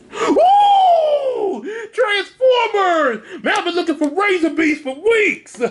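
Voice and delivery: very high, laughing, thrilled shout; Will excited. Retold or imitated. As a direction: squeal like a kid finding toys. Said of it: "one of the ones that Will was looking for".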